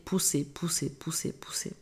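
fading to a whisper; unvoiced trailing whisper. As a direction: breathe the repeats out, dying away. Whispered repetition of pushing.

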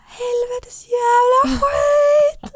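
high, drawn-out wailing curse; retold distressed wail. Telling a friend how her waters broke, high distressed wail. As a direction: stretch the swearing into a loud, high howl.

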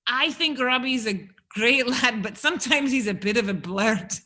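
Scouse slipping into Scottish; bad Scouse attempt. Shown as a demonstration. Host copies the guest's line, failed Scouse accent sounding Scottish. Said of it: "That sounded Scottish".

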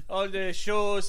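loud, slow, high declamation; mock wartime orator. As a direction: carry on the grand speech, hammed up. Mock quote of wartime speech.

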